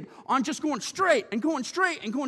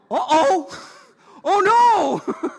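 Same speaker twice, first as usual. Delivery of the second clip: loud comic uh-oh; 6 semitones higher, 10 dB louder, much slower than usual. Retold or imitated. Retold rollerblade mistake.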